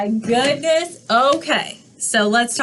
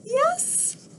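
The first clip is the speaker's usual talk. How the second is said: very high, soft tiny peep of a yes; a little duckling squeaking; lightly voiced duckling; 5 dB quieter than usual. Duckling answers.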